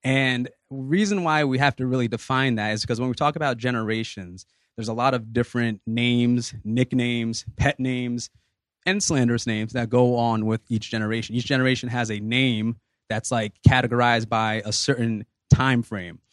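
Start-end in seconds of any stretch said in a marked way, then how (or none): none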